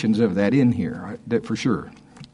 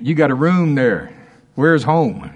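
louder and higher, bursting out; excited; 4 semitones higher, 5 dB louder than usual. Real, not acted. Preacher rejoicing to congregation, exclaiming louder. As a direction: let the joy break loose. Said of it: "I'm excited about that".

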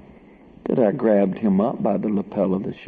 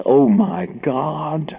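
drawn-out, higher mock cry; slow, mildly raised exclamation; imitated outcry; 6 semitones higher, much slower than usual. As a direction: mimic a shocked woman crying out. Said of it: "cried out in a loud voice".

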